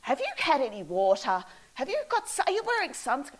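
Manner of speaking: imitating an older lady who fusses like a mother; fussy mum. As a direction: play a worried mum checking on hitchhikers. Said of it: "they were like".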